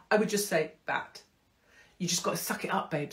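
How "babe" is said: ordinary talk, nothing marked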